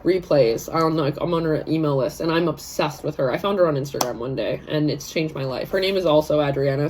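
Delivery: usual speaking voice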